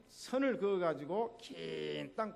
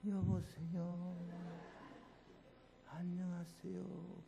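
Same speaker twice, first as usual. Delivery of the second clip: very slow, faint and drained; half-dead phone voice; 10 dB quieter, much slower than usual. Acted. Expected weary pastor answering a caller, faint and drawn-out. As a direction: imitate someone barely alive answering. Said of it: "다 죽어갈 줄 알았대요".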